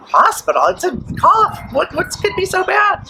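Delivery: high, incredulous squeak of disbelief. Retold or imitated. To the doctors who want her in hospital, high disbelieving voice.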